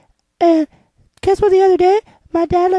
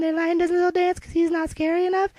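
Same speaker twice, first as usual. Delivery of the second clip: ordinary talk, nothing marked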